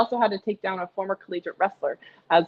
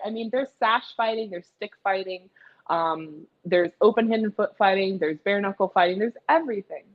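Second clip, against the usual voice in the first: usual speaking voice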